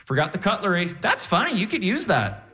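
high, retold mom remark; mom's voice.

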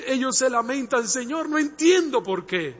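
loud, fervent sermon delivery; full-force Spanish preaching. Acted. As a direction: preach it out to the whole church. Preaching to the congregation.

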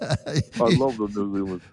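a shaky, slowed attempt at a New Zealand accent. Shown as a demonstration. Film reviewer trying the New Zealand accent he loves, slowed, half-hearted Kiwi accent. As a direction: put on a Kiwi accent, not quite landing it. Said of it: "I don't do it very well".

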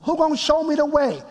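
high-pitched, retold protest; upset plea to God. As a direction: plead upward as if upset with God. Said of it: "and I'm really upset".

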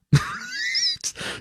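sudden high-pitched laugh; high burst of laughter. Real, not acted. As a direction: break into a squealing laugh. Host cracking up at headline.

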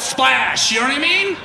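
ordinary talk

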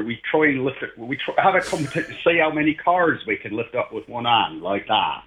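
lightly hammed Bristol yokel voice; Bristol accent. Showing the host how a Bristol farmer talks, in a mock Bristol accent. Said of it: "if I was from Bristol".

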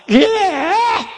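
sudden high yelled yeah; a young man's involuntary high cry of yeah; hollering out. Retold or imitated. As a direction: blurt it out high like an outburst in church. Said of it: "would be hollering out".

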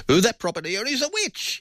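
very high, mock accusing squeal; scheming accuser. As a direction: cry witch in a hammed-up high voice. Mimicking a greedy accuser.

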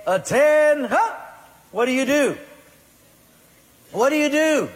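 barked high, loud order to stand at attention; army drill sergeant shouting attention; drill sergeant command. Acted. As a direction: bark it like a first sergeant calling the room to attention. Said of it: "And I'm a drill sergeant".

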